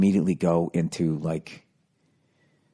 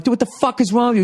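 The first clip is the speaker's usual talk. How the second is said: high-pitched, rushed road-rage yell; indignant shouted challenge, high and quick; 4 dB louder, faster than usual. Retold or imitated. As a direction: yell it fast at another driver, pitch way up. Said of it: "I'm yelling at the guy".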